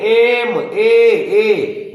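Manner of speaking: एम ए ए ए said very slowly, stretching the ए sound long; drawn-out pronunciation. Shown as a demonstration. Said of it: "इसका उच्चारण जो है". (ए की ध्वनि को खींचकर धीरे-धीरे बोलना)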